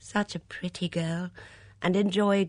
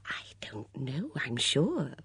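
plain reading voice

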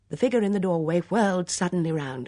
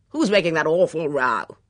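loud, blustering colonel; forceful and indignant; irritated demand; 7 dB louder than usual. Acted. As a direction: demand it with annoyed authority.

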